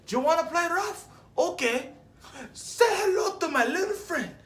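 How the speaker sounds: put-on high, loud movie-quote yell in a Cuban accent; full-out gangster shout, far above normal pitch; Tony Montana shouting. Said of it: "say it in my Tony Montana accent".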